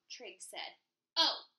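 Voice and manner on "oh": troll voice. Acted. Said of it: "said the troll".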